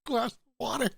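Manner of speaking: high, laughing voice. Real, not acted. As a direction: say it while cracking up. Mocking laugh at the narrator.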